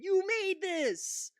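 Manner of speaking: very high, very slow, drawn-out disbelief; incredulous outburst. Real, not acted. Streamer to Fox hosts, stunned furious disbelief. Ranting at Fox News clip.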